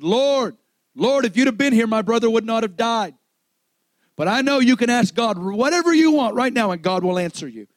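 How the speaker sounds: high, loud plea as Martha; imploring cry. Acted. Acting out Martha crying to Jesus.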